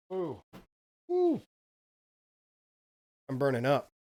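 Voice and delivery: gasping at the heartburn burn; pained oohs from heartburn. Real, not acted. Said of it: "Sorry, I'm getting heartburn".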